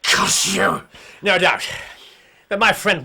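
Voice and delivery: loud outburst during the arrest. Acted. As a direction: spit it out loud. Scuffle over the handcuffed harpooner.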